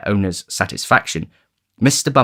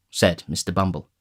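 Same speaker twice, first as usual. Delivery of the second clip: ordinary delivery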